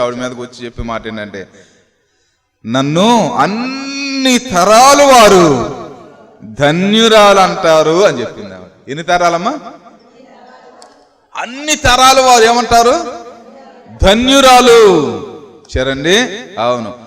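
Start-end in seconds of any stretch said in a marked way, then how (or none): 2.7-8.0 slow, stretched-out declaration, raised in pitch; scriptural proclamation. Retold or imitated. Proclaims Mary's words from scripture. 11.4-14.8 high, ringing question and answer; preacher's call-out. Real, not acted. Calls for congregation's answer, proclaims it.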